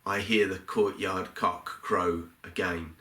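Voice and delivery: normal talking voice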